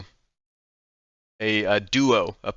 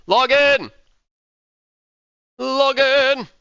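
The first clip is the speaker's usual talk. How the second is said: shouting; 11 semitones higher, 5 dB louder, much slower than usual. Real, not acted. Calling out to fans.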